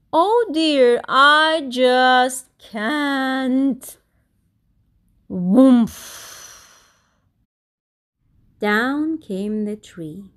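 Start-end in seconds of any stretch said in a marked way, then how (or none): 0.1-4.0 frightened wail. Acted. Scared Kevin clinging. 5.3-6.3 loud woomph. Acted. Tree falling sound.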